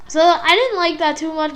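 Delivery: ordinary talk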